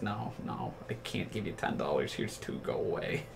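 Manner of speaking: waving him off under your breath; dismissive brush-off. Retold or imitated. Brushing off the beggar.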